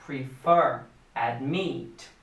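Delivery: Prefer and admit, each stressed on the second syllable. Shown as a demonstration. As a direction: lean on -FER in Prefer and -MIT in admit. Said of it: "A sílaba tônica é a segunda".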